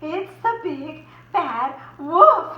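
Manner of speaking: slow, loud, high fearful pig cry; alarmed cry. Acted. Percy crying out as he sees the wolf. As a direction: cry out the danger. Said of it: "he cried".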